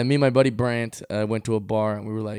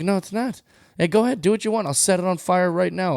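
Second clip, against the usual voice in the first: high and loud, daring him; defiant taunt; 7 semitones higher, 4 dB louder than usual. Retold or imitated. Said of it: "I was like".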